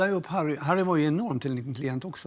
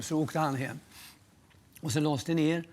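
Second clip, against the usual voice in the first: ordinary talk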